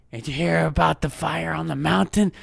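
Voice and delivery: mock short-of-breath voice; imitated wheeziness. Podcaster imitating himself last week, exaggerated wheezy voice. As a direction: talk as if badly winded. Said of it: "That was me last week".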